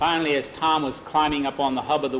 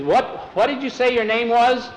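higher-pitched, probing question; questioning storekeeper; 9 semitones higher, 4 dB louder than usual. A narrator's reading. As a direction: call after the stranger, pitched up. Storekeeper suddenly questions departing stranger.

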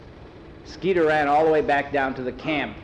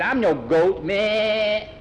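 goat bleat; 8 semitones higher, 4 dB louder, much slower than usual. Acted.

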